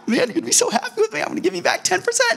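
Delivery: very high, giddy mock boasting; gleeful self-pleased giver. Believer proudly offering God ten percent, gleeful. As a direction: squeak it out, delighted with yourself.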